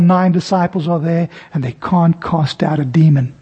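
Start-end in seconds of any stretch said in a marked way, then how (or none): none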